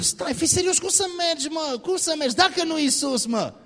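high-pitched mock yelling, kept down in volume; hammed-up high, strained objection; yelling disciples. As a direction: protest like panicked disciples scolding a reckless friend. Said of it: "urlând".

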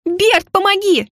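high, drawn-out squeal for help; squealed plea. A narrator's reading. Heroine being carried off, squealing to Bert. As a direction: squeal desperately for rescue. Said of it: "взвизгнула я".

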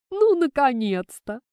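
high, loud burst of relief; joyful exclamation. A narrator's reading. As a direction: exclaim it as if long awaited. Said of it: "воскликнула она".

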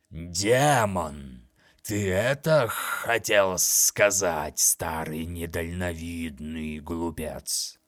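slow, sneering daemon voice; mock the old fool slowly; mocking monster voice. Monster mocks.

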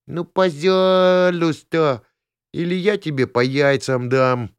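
put-on high, slow begging girl; pleading. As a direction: beg sweetly, then threaten. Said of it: "умоляющие глаза".

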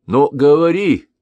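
high, loud slow call to speak; urging demand. Acted. Council urging the Kagan to speak up. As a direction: press him to talk now. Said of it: "поторопили его со всех сторон".

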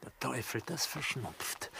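understated sulky aside; grumbling aside. Acted. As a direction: mutter to yourself, stung.

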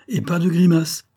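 high, loud warning; strict director's command; pitiless order. A narrator's reading. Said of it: "fit-il impitoyablement".

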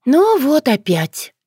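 high, drawn-out lament; sighing resignation. A narrator's reading. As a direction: sigh it out, weary of the same trouble. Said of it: "вздохнула яга".

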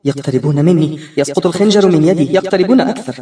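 ordinary delivery, nothing marked

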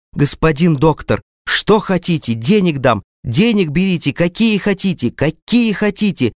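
a father begging the doctor to save his daughter; desperate shouting. A narrator's reading. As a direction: beg frantically, climbing upward. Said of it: "кричал он в потолок".

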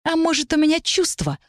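high-pitched, scornful retort; spat out. A narrator's reading. Mage claims feelings to his rival, spitting it out. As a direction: spit it out high through a twisted lip. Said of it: "скривив губы, выплюнул Каэль".

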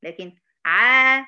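ع stretched slowly and loud; drawn-out loud ع. Shown as a demonstration. Teacher stretches the ع for the class. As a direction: hold ع long from the throat. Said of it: "ما افتحش الفكين".

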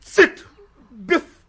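bursting in, shouting with joy; a loud, overjoyed cry; loud excited outburst. Acted. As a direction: shout it out, overflowing with glee.

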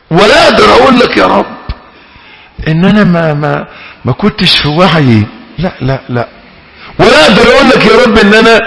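a repentant sinner begging, building to a near cry; pleading sinner. Acted. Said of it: "انك تقول له". (مناجاة تائب متوسل بصوت يعلو ويرتفع)

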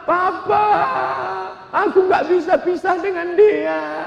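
high, tearful pleading; hammed-up weepy wife; mock tearful plea. Mother pleading with husband. (istri merengek kepada suami sambil menangis)

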